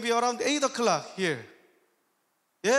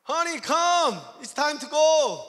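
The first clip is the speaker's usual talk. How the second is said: higher, louder call to spouse; raised voice, summoning; 4 semitones higher, 5 dB louder than usual. Retold or imitated. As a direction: call out for honey to come along. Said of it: "So I call".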